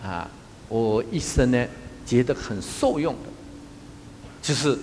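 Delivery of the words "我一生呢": ordinary delivery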